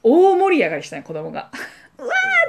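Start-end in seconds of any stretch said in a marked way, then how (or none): 2.0-2.5 very high squealing whoa; delighted children cheering; kids' cheer. Retold or imitated. Kids cheering at the mock shooting.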